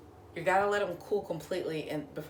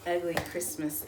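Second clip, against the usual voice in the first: ordinary talk, nothing marked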